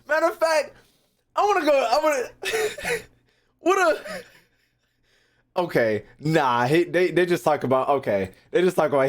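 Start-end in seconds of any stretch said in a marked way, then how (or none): 0.1-4.0 excited. Real, not acted. Excited, laughing.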